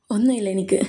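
whispered, holding back tears; hushed denial. Acted. Aadi tells Karthi nothing is wrong, hushed. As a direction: barely let it out, softly.

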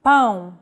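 Pão with a nasal AO glide; nasal vowel. Shown as a demonstration. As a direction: send the vowel of Pão through the nose. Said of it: "To indicate nasal vowels, the tilde is used".

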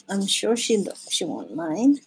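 ordinary talk

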